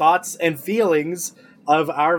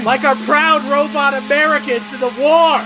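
raised, higher-pitched indignant protest; impassioned outburst; 6 semitones higher than usual. Acted. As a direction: proclaim it hotly over the noise. Teacher protesting over maintenance noise.

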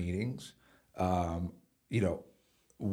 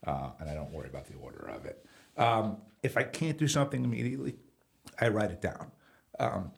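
usual speaking voice

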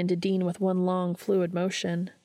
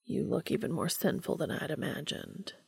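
murmuring; 7 dB quieter than usual. Acted. Cass aroused, murmuring.

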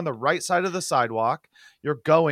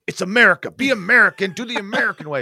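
hammed-up flag-waving American, louder; mock patriot; 5 semitones higher, 6 dB louder than usual. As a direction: bark it like a proud patriot. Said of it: "I don't wanna say it like this".

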